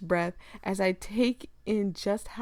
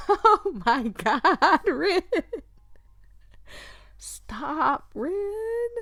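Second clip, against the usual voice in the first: giggling, flustered protest; flustered laughing; 9 semitones higher, 4 dB louder, much slower than usual. Real, not acted. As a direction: laugh it out, embarrassed. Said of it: "I'm trying to keep a straight face".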